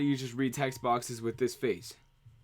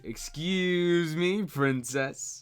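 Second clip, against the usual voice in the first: drawn-out, higher cartoon hero voice; Link; 4 semitones higher, much slower than usual. Streamer auditioning to voice Link, slow put-on cartoon voice. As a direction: stretch it out like a cheeky catchphrase. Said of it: "I should just voice Link".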